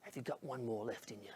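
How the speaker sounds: very soft, low, confiding voice; leaning-down encouragement. Retold or imitated. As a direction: quietly coax an exhausted fighter. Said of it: "leans down to Zach and he goes".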